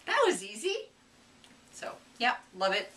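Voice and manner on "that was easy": high, loud pleased "that was easy"; surprised at how easy cables were; surprised delight. Retold or imitated. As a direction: pop up bright and pleased. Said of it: "I went".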